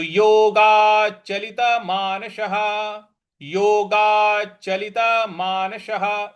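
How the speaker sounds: मानसः ending in an echo ह; visarga as ह. Shown as a demonstration. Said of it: "इस प्रकार से बोलेंगे".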